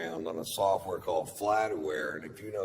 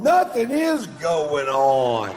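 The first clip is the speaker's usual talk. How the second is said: louder, slow, every word spaced out; emphatic insistence; 6 semitones higher, 9 dB louder, much slower than usual. Retold or imitated. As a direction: hammer home the innocence. Dog telling owner act innocent.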